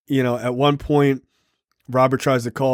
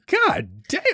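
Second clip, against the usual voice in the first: much higher, wide-eyed exclamation; awed god damn; 12 semitones higher, 3 dB quieter than usual. Retold or imitated. Telling friends how the scene hit him, awed disbelief. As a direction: replay being floored by the line. Retelling his own reaction again.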